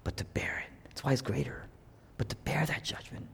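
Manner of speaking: soft whisper on bearing judgment. Real, not acted. Preacher whispering about Jesus bearing judgment. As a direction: drop to a hush.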